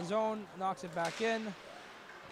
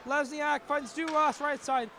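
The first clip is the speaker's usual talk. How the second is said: slow, climbing to a higher, louder call on the shot; building excitement; 4 semitones higher, 6 dB louder, slower than usual. Real, not acted. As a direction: stretch the call as he shoots.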